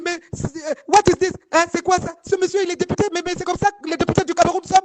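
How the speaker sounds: high-pitched retold distress, little real crying; upset, tearful imitation. French friend questions how deputies behave, mock-distressed. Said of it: "La Française tremblait, elle pleurait".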